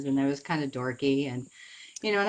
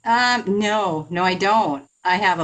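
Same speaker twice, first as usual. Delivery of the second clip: louder, glitchy phone audio; buffering stutter; 4 semitones higher, 8 dB louder, slower than usual. Real, not acted. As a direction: crackly call breaking up. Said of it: "Sounds like a bad connection".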